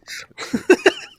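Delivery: loud, high cackle; high burst of laughter. Real, not acted. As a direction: crack up hard. Laughs at own joke.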